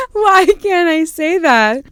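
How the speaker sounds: high laughing near tears. Real, not acted. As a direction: laugh through the words, almost crying. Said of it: "I'm not gonna be able to keep a straight face".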